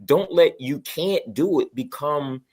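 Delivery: ordinary talk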